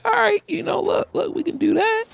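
very high-pitched mock agreement; put-on high voice. Reviewer voicing his answer to Sony's offer, put-on high voice.

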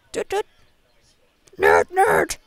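put-on sing-song the taunt like a bratty kid; mock taunting kid. Retelling a kid from Hoenn who taunted him, mock chant. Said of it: "was like, was".

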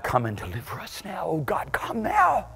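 desperate whispered plea to God, slowing down; whispered prayer. Acted. Church crying out in prayer.

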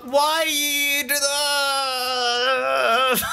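hammed-up crying whine, high and drawn out; mock wail. Frustrated at the game, mock wailing.

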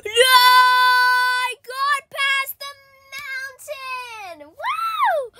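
high, loud squeal of triumph; whooping with disbelief; ecstatic cheer. Real, not acted. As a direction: shriek it like you just won. Gets past the mountain, cheering.

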